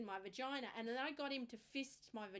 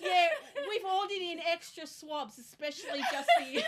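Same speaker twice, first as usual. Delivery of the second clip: louder, higher, laughing through the words; laughing tease; 7 semitones higher, 15 dB louder than usual. Retold or imitated. Pathologist joking about extra swabs, laughing. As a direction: tease her while laughing. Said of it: "He's gone".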